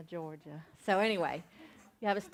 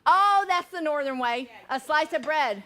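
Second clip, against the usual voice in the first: higher and louder, half-laughing realisation; mock-shocked, louder discovery; amused surprise; 5 semitones higher, 8 dB louder than usual. Real, not acted. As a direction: react as if catching a Yankee habit. Reacting to the bread trick.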